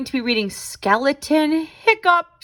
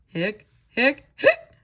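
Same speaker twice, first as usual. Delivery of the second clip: squeaky hiccups. Acted. Skeleton hiccups in picture book.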